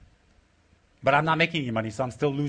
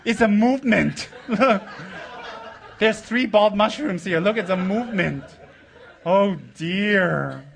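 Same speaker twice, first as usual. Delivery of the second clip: laughing; 3 semitones higher, slower than usual. Real, not acted. Laughing at bald heads.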